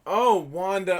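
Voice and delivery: normal talking voice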